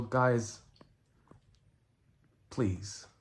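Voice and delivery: ordinary talk, nothing marked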